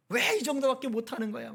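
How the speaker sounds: hammed-up reproach; imitated angry scolding. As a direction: act out nagging a disappointing child. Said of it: "그러면서".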